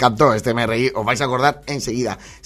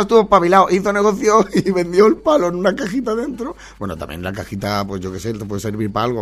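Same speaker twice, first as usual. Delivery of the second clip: ordinary talk, nothing marked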